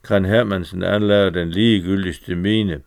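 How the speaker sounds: plain reading voice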